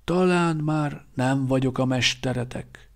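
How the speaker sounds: put-on a knowing old teacher teasing; old quartermaster Szíba. A narrator's reading. Szíba voiced.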